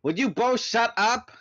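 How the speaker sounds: loud, high, fed-up demand. A narrator's reading. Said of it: "I demanded".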